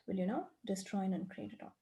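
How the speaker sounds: quick, soft, murmured recital of a set formula; rote clearing formula. Acted. Coach reciting the clearing statement for the client, quick and soft. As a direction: rattle off the clearing words under your breath.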